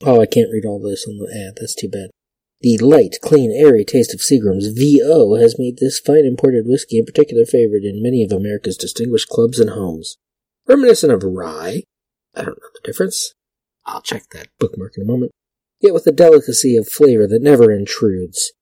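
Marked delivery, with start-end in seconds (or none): 2.6-11.8 louder vintage announcer read. Acted. Vlogger reads an old whiskey ad aloud in announcer voice. 15.8-18.5 louder vintage announcer read. Acted. Vlogger reads an old whiskey ad aloud in announcer voice.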